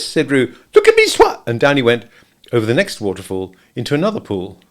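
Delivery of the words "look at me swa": very high, loud squeak. A narrator's reading. Little Roo calling out from the pool, squeaking. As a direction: squeak it like a tiny excited kangaroo.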